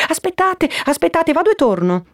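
very high-pitched, urgent hold-on; hasty relenting. Acted. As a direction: stop them before they walk off. Perpetua hastily stops Toni leaving. (affrettato, a voce molto acuta)

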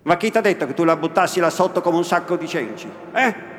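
loud, fast, furious rebuke; angry scolding. Acted. Innominato scolds the old woman for how Lucia is kept, angry and loud. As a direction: tear into her, scolding hard. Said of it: "disse la vecchia con cipiglio iracondo".

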